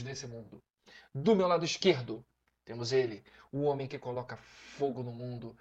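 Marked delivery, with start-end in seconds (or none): 1.1-5.6 grand introduction, drawing out the name; dramatic announcer intro. Acted. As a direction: build up each name like a show host.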